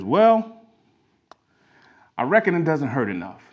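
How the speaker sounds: southern twang. Acted. Gas station attendant explains the dog, southern twang. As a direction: deliver a punchline in folksy southern twang. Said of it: "in this southern twang".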